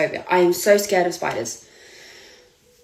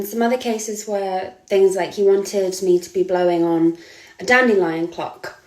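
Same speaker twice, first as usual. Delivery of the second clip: normal talking voice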